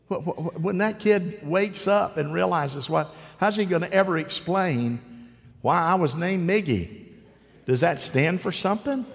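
high, loud incredulous outburst. Real, not acted. Preacher mocking baby names, loud and incredulous. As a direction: rant in mock disbelief over a silly name. Indignant about the name Miggy.

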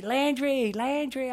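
put-on high singsong coo to a baby; playful high-pitched baby talk; calling a baby. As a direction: coax a one-year-old's attention, high and drawn out. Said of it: "so I'll say".

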